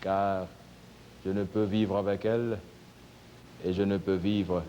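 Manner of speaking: low, despondent lament; moaning. A narrator's reading. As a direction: lament your lot, low and halting. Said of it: "l'homme se retira en gémissant". (gémissement abattu, d'une voix basse)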